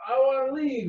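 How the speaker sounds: high, whining plea; whiny Luke. Retold or imitated. Luke whining at his uncle, high sulky plea. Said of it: "Luke's a little whiny bitch".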